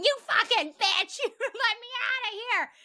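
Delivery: high, loud mock screech; mock screaming. Chucky yells from the trunk, high loud mock screech. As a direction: screech it like a trapped doll.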